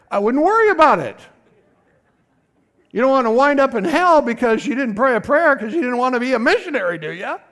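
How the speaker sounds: loud, high, driving the point home; forceful preaching. Real, not acted. Preacher warning the congregation about hell, loud and forceful. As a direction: preach it out hard over the room.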